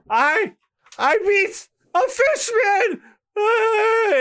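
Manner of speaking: high, slow yelled victory; high cartoon victory yell; high triumphant shout. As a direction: cry out in triumph. Voicing Usopp's triumph.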